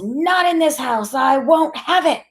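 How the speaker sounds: high-pitched anguished outburst; distraught yelling. A narrator's reading. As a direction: wail it in upset disbelief. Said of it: "Graham yells".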